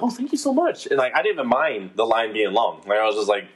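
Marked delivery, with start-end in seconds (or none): 0.0-0.9 very high, sweet, eager voice; gushing politely at the barista; customer service voice. Retold or imitated. His own overly sweet replies.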